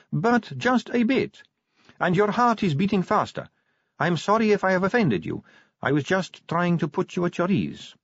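high-pitched vampire recruit; Sally. A narrator's reading. Sally speaks to Vimes.

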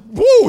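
high, loud mock yelp; startled whoop. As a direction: play the devil scared off with a whoop. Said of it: "The devil's like".

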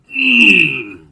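high loud mock moan; hammed-up pleasure moan; exaggerated moan. Moan ending the sultry bit.

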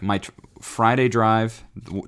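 ordinary talk

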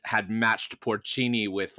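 ordinary talk, nothing marked

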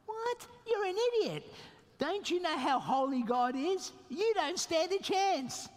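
high, incredulous scolding retort; mock exasperation. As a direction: snap back in disbelief at a fool. Preacher mocks defiant churchgoer.

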